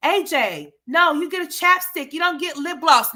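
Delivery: high, loud mimic of the mother; playful imitation of the scolding mom; mock mom. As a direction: parody her nagging. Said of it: "she just kept".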